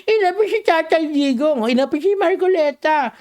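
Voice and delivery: high, whiny mocking lament; mock whining supporter. Host mocks crying supporters, high whiny lament. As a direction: mimic a crybaby supporter complaining. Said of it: "you're crying?".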